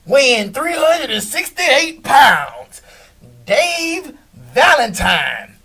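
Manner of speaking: loud, slow, drawn-out ring announcer call; arena announcer calling a fighter to the ring; stadium announcer. Retold or imitated. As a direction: boom it out like a boxing intro. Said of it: "like those announcers at the arena or the stadium".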